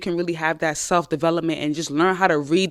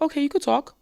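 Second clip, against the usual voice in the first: higher, offhand okay; cool permission; 7 semitones higher than usual. Pretend. Woman letting her boyfriend finally speak, cool and offhand. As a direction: grant it coolly. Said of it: "you could be like".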